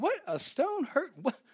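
high-pitched, put-on incredulous questioning; mock bafflement. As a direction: play the puzzled doubter, voice jumping up. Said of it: "You think".

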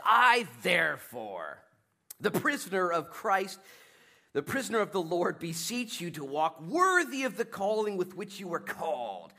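loud, lofty scripture proclamation; scripture reading. Acted. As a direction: proclaim Paul's words with weight. Reading Paul's scripture aloud.

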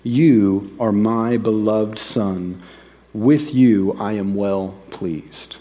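very slow, measured divine pronouncement; voice from heaven. Retold or imitated. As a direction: declare it solemnly, word by word. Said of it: "the voice from heaven itself comes and says".

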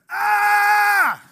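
a high, loud shout of frustration; frustrated yell. Retold or imitated. Son yelling in the bedroom, a frustrated high yell. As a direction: let out a child's disappointed yell. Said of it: "all you hear in the bedroom is".

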